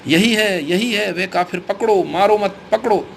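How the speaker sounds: crying out. A narrator's reading. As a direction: call out the alarm to your men. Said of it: "मौलवी चीख उठा".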